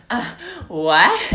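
high, loud "uh, what?" breaking into laughter; hammed-up confusion; obnoxious voice. Shown as a demonstration. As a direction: play dumb at the guard, then crack up. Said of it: "the most obnoxious voice you can".

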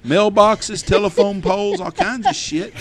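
amazed retelling; excited listing. Real, not acted. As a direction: pile up the damage in disbelief. Excited listing of wreck debris.

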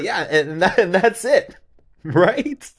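high and loud, laughing through it; excited laughing retelling. Real, not acted. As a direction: crack up while telling it.

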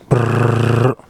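rolled trilling cat purr; imitated cat purr. Shown as a demonstration. Cohost imitates a cat for the host, trilled purr. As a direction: do a buzzing purr with the lips. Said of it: "That's not how cats sound like".

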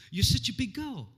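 a touch of cooing praise; fond praise. Retold or imitated. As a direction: applaud a little girl. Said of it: "I use that phrase".

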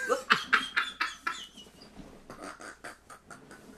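long wheezing laugh; laughter. Real, not acted. Cracking up at his own divorce joke, wheezing laugh. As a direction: collapse into breathless laughter.

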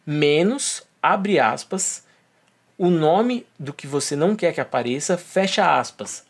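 slow, spelled-out search syntax; dictating. Shown as a demonstration. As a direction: dictate each symbol slowly. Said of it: "colocando no ato da pesquisa".

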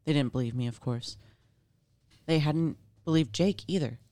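usual speaking voice

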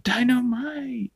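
high drawn-out sitcom catchphrase; catchphrase impression. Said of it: "he's like".

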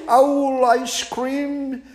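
very high, slow, horrified owl echo; the owl echoing in high alarm. Acted. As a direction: hoot it back in shocked disbelief.

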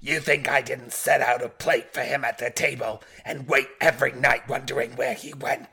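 loud, indignant New York robot voice; hammed-up New York outburst, robotic and forceful; full New York inflection. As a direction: lay the New York accent on thick and loud. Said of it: "You need the, the New York inflection".

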